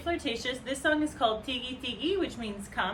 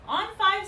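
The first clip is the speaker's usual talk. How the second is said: higher, louder "five" called out; stress on five; 8 semitones higher, 6 dB louder than usual. Shown as a demonstration. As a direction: call out "five".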